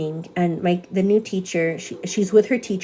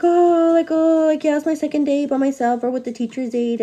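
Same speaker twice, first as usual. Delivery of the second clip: flustered new teacher; 7 semitones higher than usual. Retold or imitated. New teacher admitting her hard day, high flustered voice. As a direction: play the overwhelmed new teacher.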